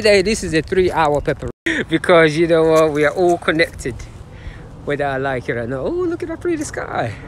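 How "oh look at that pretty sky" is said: delighted. Real, not acted. Delighted at the sky.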